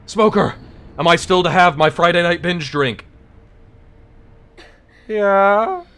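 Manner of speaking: high, loud hammed-up shout; mock yell. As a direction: yell it in a silly voice. Mock yelling NPC lines.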